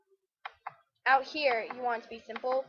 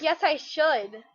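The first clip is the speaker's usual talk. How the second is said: louder, slow, stubborn "Yes, I should."; emphatic retort; 4 semitones higher, 7 dB louder, much slower than usual. Retold or imitated. Talking back to people who reassure her, emphatic retort. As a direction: insist slowly: "Yes, I should.". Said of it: "I'm like".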